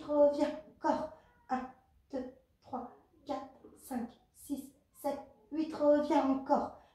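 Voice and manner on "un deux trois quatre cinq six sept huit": compte les répétitions à voix basse, régulier; égrène les temps doucement, en coach; comptage des répétitions. Acted. Coach counts the exercise reps. (compter les répétitions doucement)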